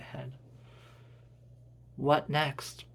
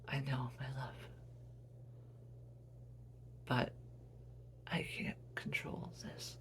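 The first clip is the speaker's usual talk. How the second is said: whispered, breathless apology; soft dying whisper; hushed whisper; 12 dB quieter than usual. Acted. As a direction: barely voice it, fading. Dying mother answers son.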